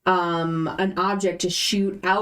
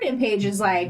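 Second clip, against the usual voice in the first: ordinary delivery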